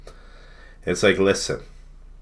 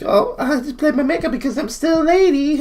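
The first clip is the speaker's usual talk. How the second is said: very high, squealing falsetto; hammed-up dainty lady, pitched way up; mock lady voice; 5 dB louder than usual. Mocking the burger-eating woman about her makeup, falsetto lady voice. Mimics woman from the played clip.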